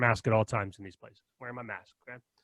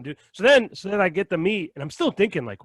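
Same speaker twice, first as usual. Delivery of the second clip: ordinary talk, nothing marked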